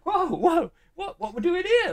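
high-pitched mock astonishment; acting bewildered. Taxi passenger acting as if he never expected this, mock bewilderment. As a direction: play baffled in a squeaky, laughing imitation. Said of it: "he's acting as if it's like".

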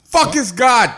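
high, loud mock outrage; scornful, high-pitched yell; indignant outburst. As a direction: explode in disbelief. Mocking the Americanized pronunciation.

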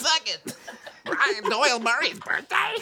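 high mock-shocked voice; imitating Kristen. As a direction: squeal it like a starstruck fan. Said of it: "That's not what she sounds like".

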